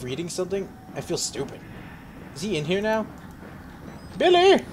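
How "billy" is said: calling out. Real, not acted. Calling out to the monster.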